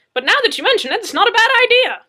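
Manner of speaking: high, loud needling retort; Madzeke's voice. Acted. Madzeke presses Murphy, high and loud.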